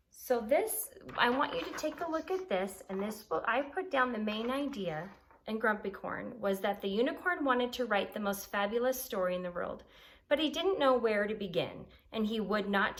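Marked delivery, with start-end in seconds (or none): none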